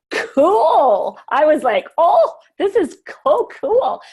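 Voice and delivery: high, loud delighted squeal; high excited "so cool"; thrilled. Retold or imitated. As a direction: gush with excitement over the news. Retold own delight at the news.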